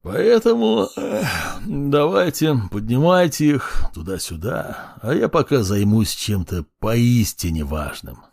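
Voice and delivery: bored, sleepy orders; lazy yawning. Acted. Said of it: "лениво зевая".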